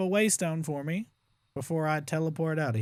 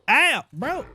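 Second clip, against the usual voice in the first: pained yelp; 9 semitones higher, 7 dB louder than usual. Real, not acted. Hit by the Ravager.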